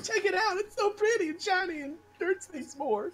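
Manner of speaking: giddy, high-pitched gushing; excited high voice. Acted. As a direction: show off the new blade like a delighted kid.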